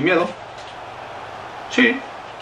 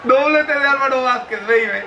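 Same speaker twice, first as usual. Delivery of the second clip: goal commentary; 10 semitones higher, slower than usual. Real, not acted. Announcing the goal scorer.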